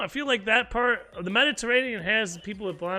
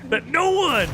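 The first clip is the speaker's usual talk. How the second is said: excited rant; 9 semitones higher, 4 dB louder, slower than usual. Real, not acted. Ranting at player hogging games.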